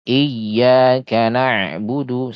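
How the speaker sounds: "iyyaka" slow, yy pressed; held tasydid. Shown as a demonstration. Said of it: "contoh yang di awal".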